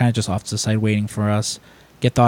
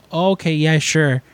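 higher-pitched imitation of politely going along; polite agreement; 6 semitones higher, slower than usual. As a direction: humour them politely. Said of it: "he's, he's very polite".

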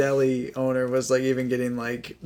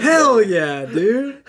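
loud, raised, laughing cheer; mock-hype cheer; 6 semitones higher, 4 dB louder, much slower than usual. Friend cheering co-host's Gosling knowledge, mock hype. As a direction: whoop it up for your buddy.

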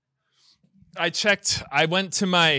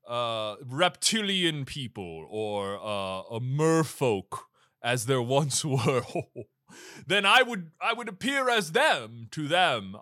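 vast deep cosmic voice; 7 semitones lower than usual. Acted. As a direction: speak like an ancient cosmic being. Santa as cosmic being.

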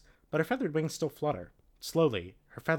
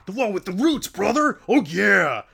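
loud, high exasperated yell; impatient shout; 9 semitones higher, 12 dB louder than usual. Acted. Rachel impatient with Marco, loud and exasperated. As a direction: snap it out impatiently. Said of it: "Rachel says impatiently".

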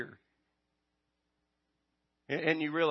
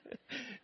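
short laugh; 14 dB quieter than usual. Real, not acted. Laughs at own slip.

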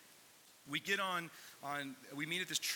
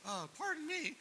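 very high, sing-out "excuse me" voice; mock-polite call; 13 semitones higher than usual. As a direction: call for attention in comic falsetto. Timidly flagging down the guide.

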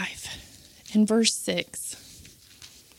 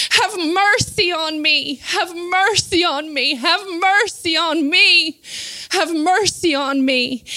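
slow, insistent repeated plea; fervent, drawn-out appeal; crying out; 6 semitones higher than usual. Acted. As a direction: plead for mercy, stretching the words. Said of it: "willing to cry out even louder".

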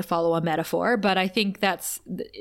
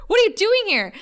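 high, loud mock exclaim; 11 semitones higher, 5 dB louder, faster than usual. Buffy finding a stranger in her room, loud mock exclaim. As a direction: fling it out, hammed-up shock. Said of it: "She doesn't say Harriet".

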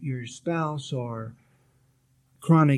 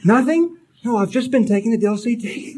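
high and loud, laughing through the patient's words; laughing retelling; 6 semitones higher, 6 dB louder than usual. Retold or imitated. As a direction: laugh out the woman's reply. Patient retold, laughing at story.

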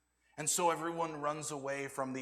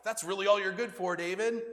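louder contemptuous put-down; belittling; 4 semitones higher, 6 dB louder than usual. Retold or imitated. Eliab belittles David, louder and contemptuous. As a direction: sneer the name at him. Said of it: "So Eliab belittles David".

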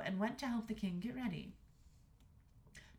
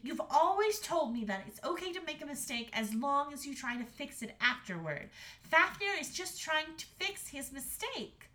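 higher-voiced reasoning daughter; earnest Astrid; 6 semitones higher than usual. A narrator's reading. Daughter arguing for the dragon to mother, earnest. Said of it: "said Astrid".

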